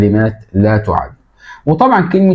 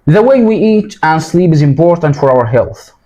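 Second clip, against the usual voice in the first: slow, raised classroom reading voice; teacher reading the passage; reading aloud to class; 6 semitones higher, slower than usual. Shown as a demonstration. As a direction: read it out clearly for learners. Reads English text to class.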